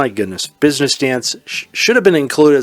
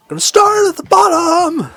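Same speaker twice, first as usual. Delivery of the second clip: high falsetto shriek, louder mock scream; 5 dB louder than usual. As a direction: shriek it like a singer's stage scream. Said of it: "[name] would scream it".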